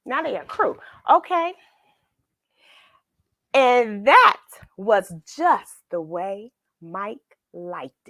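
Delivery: lively picture-book narration; storybook reading. A narrator's reading. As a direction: read it like bedtime story time. Reads picture book aloud.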